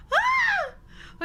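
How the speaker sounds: sudden very high shriek; startled scream. Real, not acted. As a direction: jolt and shriek. Sudden scream while reading.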